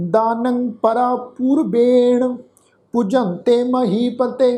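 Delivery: slow, measured chanting of the verse; scripture recitation. Shown as a demonstration. Teacher leading the sangat through the shlok, slow recitation. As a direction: recite each syllable slowly for the congregation.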